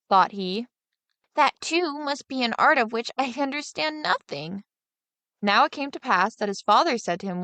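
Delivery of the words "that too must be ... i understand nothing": put-on higher, lightly puzzled boy's voice; boy mocking what others always say, higher and puzzled; simple younger son. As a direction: play the naive lad wondering aloud.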